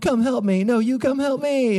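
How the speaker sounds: high, whiny mock pleading; bickering spouses on the phone, each in a high whiny plea. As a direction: squeal it like a helpless spouse. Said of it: "on the phone with each other like".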